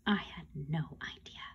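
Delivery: low, whispered admission; barely voiced confession to the listener; hushed aside. A narrator's reading. Narrator's hushed, clueless aside.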